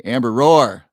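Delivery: loud called-out "roar"; big mock-lion shout of the word; playful roar. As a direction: belt the word out as a lion greeting. Playful roar greeting to a viewer.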